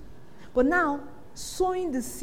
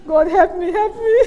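panicked prayer; 4 semitones higher, 7 dB louder than usual. Retold or imitated. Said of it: "Speak in tongues".

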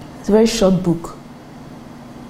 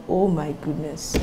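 ordinary talk

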